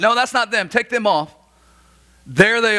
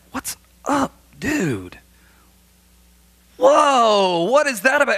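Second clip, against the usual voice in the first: mock surprise; 7 semitones higher, slower than usual. Preacher retells hallway surprise.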